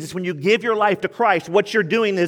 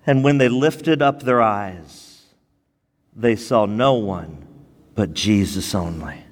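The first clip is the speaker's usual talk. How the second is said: slow, measured reading of the verse, lower and drawn out; reading scripture aloud; 9 semitones lower, slower than usual. Shown as a demonstration. Pastor leading the church in reading, slow and measured. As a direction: read the verse slowly with the church. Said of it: "Read it with me right now".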